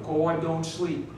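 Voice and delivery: slow saying. Shown as a demonstration. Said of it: "here's another one".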